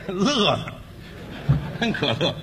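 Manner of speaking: soft, strained laughter that sounds close to crying; forced laugh. Pretend. Insisting to his partner he is laughing, strained half-sob laugh. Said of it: "这不哭了吗？".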